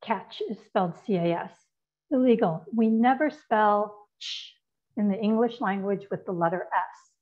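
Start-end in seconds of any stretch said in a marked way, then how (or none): 2.1-2.7 Illegal said with a louder falling tune; firm verdict: Illegal; emphatic illegal. Shown as a demonstration. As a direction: call it out like a verdict. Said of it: "what are called illegal substitutions".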